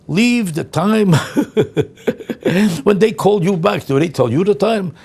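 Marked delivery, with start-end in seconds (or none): 0.1-1.1 very slow, higher, sneering; mocking instruction. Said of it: "why do I have to tell you". 1.2-2.8 laugh. Real, not acted. Laughs at own mockery.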